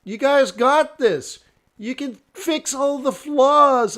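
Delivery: high, drawn-out pleading insistence; earnest protest. Retold or imitated. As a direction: urge the doubters on, acting it out. Retold earnest protest to the laughers.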